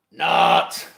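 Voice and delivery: loud, cheeky "Not"; loud retraction. Real, not acted. Vlogger takes back his apology with a loud "Not". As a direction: punch out a joking "Not". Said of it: "I take that back, what I said".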